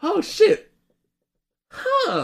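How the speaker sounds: high, startled "oh shit"; surprised gasp. Retold or imitated. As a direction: voice jumps up in surprise. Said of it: "Then I'm like".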